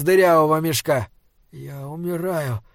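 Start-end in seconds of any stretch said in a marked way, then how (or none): 1.5-2.6 soft, fading inner voice; barely voiced, failing strength; faint dying thought. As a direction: let it out weakly, as if dying. Dying hero's faint last thought.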